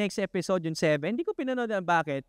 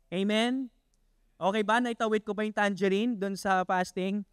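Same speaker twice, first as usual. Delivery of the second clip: ordinary talk, nothing marked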